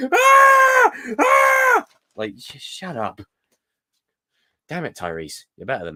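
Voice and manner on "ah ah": high, loud imitation screaming. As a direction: scream like a shouting action hero, hammed up. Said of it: "all he seems to do now is just go".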